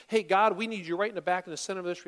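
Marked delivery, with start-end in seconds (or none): none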